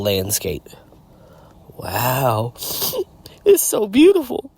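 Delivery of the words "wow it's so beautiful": high, squeaky mock-tearful gush; fake tears. Deadpool moved by a beautiful landscape, fake tears. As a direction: pretend to choke up, pitch shooting up. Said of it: "as I hold back fake tears".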